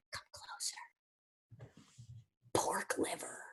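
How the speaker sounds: unvoiced conspiratorial whisper; secret whisper. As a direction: lean in and share a secret. Revealing a secret ingredient.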